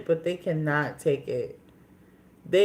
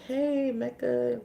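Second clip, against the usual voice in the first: high, drawn-out mock call to a coworker; 7 semitones higher, 3 dB quieter, slower than usual. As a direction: stretch out a higher-pitched greeting, without real volume. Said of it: "I shouted out her name".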